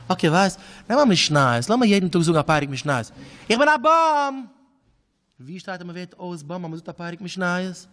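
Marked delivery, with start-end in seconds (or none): 3.5-4.5 mock declaration. Boy declares he is tree.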